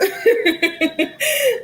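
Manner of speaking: sudden high squealing laugh. Real, not acted. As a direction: burst into a high giggle. Squealing laugh after banter.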